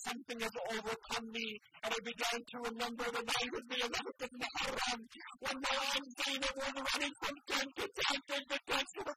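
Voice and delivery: rising mournful sermon climax; impassioned preaching. Acted. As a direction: swell into full lament as the story peaks. Sermon lament builds to climax.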